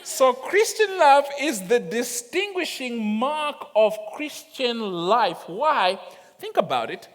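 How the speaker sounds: loud, high preaching, pressing every point; full-force preaching. Acted. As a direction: preach it out to the congregation.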